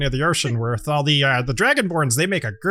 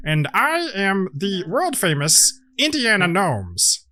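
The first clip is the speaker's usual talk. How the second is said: boastful gnome; 4 semitones higher than usual. Acted. Indiana Gnomes introduces his friends, higher and proud. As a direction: show off your crew like a showman.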